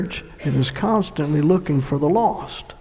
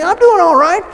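high, mocking self-satisfied whine; mock the smug churchgoer; imitating a self-righteous person; 14 semitones higher, 5 dB louder than usual. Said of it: "And you think".

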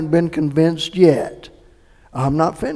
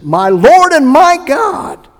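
awed exclamation; 10 semitones higher, 7 dB louder, slower than usual. Acted. Thomas meeting risen Jesus.

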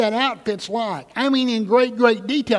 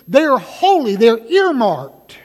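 loud and higher, slowing down; preaching shout; 3 semitones higher, 9 dB louder, slower than usual. Acted. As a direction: hammer the point home. Preacher peaks.

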